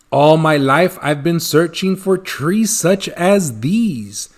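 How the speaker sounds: ordinary delivery, nothing marked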